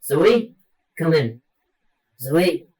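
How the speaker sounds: loud radio-style hail; calling out. Acted. Calling Zoe to come in, loud and expectant. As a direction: hail her and wait for an answer.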